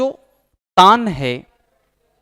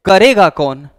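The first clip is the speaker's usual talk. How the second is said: करेगा कौन loud, weight on करेगा; करेगा stressed hard, dismissive; scoffing question; 7 dB louder than usual. Shown as a demonstration. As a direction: challenge them with करेगा कौन. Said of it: "ये बहुत tough काम है".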